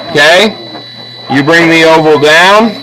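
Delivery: ordinary talk, nothing marked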